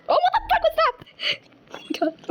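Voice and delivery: high, drawn-out startled cry. Real, not acted. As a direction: yelp in shock at a sudden scare. Startled by a scare in game.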